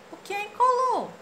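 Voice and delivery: high far-off cartoon voice; a confused little boy. (menino confuso, voz aguda)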